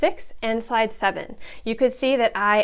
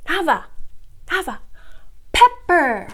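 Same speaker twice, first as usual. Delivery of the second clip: urging involvement; 6 semitones higher than usual. Shown as a demonstration. Teacher getting up close to involve student, insistent. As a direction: call the name, then punch the word. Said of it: "I want my student to get more involved".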